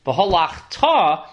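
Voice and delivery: "V'halachta" drawn out slowly, stress on the final ta; careful model reading. Shown as a demonstration. Teacher warning readers of the haftorah, slow "V'halachta" stressed on the final ta. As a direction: spell out "V'halachta" slowly, landing on ta. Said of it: "put the accent on the tuf".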